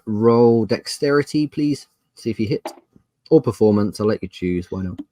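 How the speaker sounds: ordinary talk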